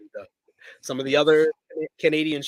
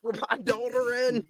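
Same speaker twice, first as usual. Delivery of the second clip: mock family-man promo, pitched up; Dax; 7 semitones higher than usual. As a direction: plead it like a wrestling promo. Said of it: "I can hear it in my Dax voice".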